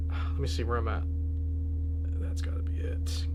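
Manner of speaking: soft, whispered frustration; muttering to self. Real, not acted. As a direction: mutter while searching the phone. Searching phone, muttering to self.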